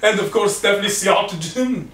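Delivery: host intro in a badly faked Scottish accent. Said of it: "hope that's trying to be Scottish".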